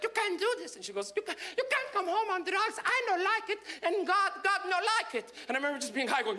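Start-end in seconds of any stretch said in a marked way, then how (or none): 0.0-0.8 high disapproving mom voice; high-pitched mock scolding. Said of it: "she's like". 1.2-5.3 put-on high, accented moral scolding; high-pitched, insistent mock mom.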